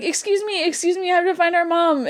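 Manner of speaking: high, urgent act of pushing through a crowd; sister's urgent pleading. Retold or imitated. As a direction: imitate a sister making excuses to get past. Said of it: "And she'd be like".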